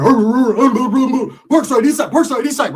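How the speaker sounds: loud, high rowdy hollering; imitated brawl shouting. Rivals fighting at the motel, loud high imitated shouting. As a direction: holler it like a scrappy melee.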